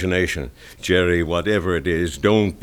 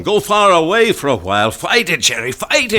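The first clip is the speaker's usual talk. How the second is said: much higher and louder, pushing him hard; urgent pleading; 9 semitones higher, 5 dB louder than usual. Acted. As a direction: beg him to fight, voice rising. Paddy urging Jerry to flee.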